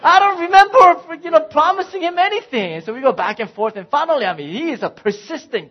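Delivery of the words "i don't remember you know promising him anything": much higher and louder, "I don't remember" thrown out in protest; husband protesting to his wife, much higher and louder; defensive loud protest. Retold or imitated. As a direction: defend yourself at full volume. Dad arguing back with wife.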